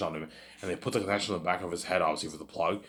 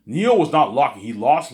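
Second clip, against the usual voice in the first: emphatic; 7 semitones higher, 12 dB louder than usual. Real, not acted. Emphatic claim.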